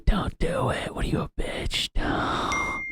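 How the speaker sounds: high breathy whisper; whispered inner voice. Retold or imitated. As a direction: whisper the temptation mockingly. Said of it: "that little voice in my head was like".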